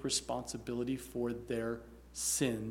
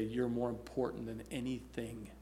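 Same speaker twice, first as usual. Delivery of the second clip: softer, tender fatherly reassurance; loving; 3 semitones lower, 8 dB quieter than usual. Retold or imitated. Dad gently reassuring his daughter after the crash, lovingly. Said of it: "but just lovingly say".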